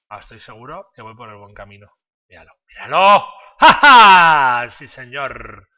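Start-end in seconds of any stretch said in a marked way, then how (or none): 2.3-5.7 loud gloating laugh; triumphant shout. Real, not acted. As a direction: crow with victory, loudly. Found the right path, triumphant.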